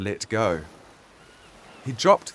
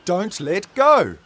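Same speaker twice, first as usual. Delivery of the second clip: urgent shout; 9 semitones higher, 7 dB louder, slower than usual. A narrator's reading. Urgent call during octopus struggle.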